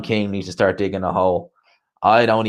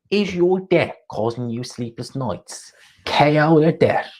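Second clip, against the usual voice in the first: half-done East London ad voice, higher and slow; Coogan's East London accent; 6 semitones higher, slower than usual. Retold or imitated. Mocking the gambling-ad pitchman to stream viewers in a rough East London accent. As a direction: ham up an East London ad read, slowly. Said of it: "I can't do Coogan's accent".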